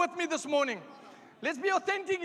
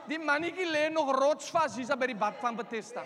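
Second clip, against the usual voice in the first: ordinary delivery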